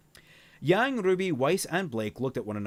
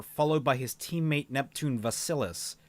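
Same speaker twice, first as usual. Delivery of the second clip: ordinary delivery, nothing marked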